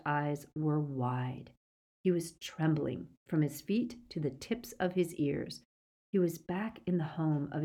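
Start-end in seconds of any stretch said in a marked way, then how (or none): none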